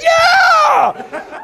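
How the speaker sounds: very high, loud whoop; excited cheer. Retold or imitated. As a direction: scream it like a jackpot win. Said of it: "I was so excited".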